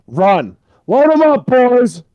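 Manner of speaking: high, full-volume rallying shout; urgent shout to get moving; yelled command. Acted. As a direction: holler the order to the squad. Yells orders to flee.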